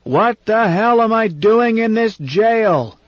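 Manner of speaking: high, slow, furious roar; raging old man demanding answers. Acted. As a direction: bellow it out at the chairman. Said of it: "He suddenly roared".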